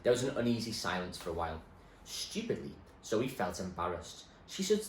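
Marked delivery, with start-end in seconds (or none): none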